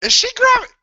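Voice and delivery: sudden high surprised question; startled by what he sees; high, disbelieving blurt. Real, not acted. Reacting to match on screen.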